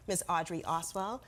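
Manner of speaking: ordinary delivery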